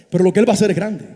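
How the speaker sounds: ordinary talk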